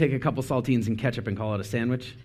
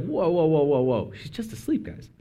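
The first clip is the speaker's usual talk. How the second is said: casual reassurance; 4 semitones higher than usual. Retold or imitated. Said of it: "And Jesus is like".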